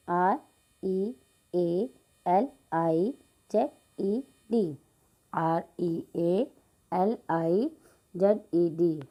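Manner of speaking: letters spaced slowly one by one; slow spelling-out. Shown as a demonstration. Teacher spelling a word slowly for students. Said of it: "spelling realized".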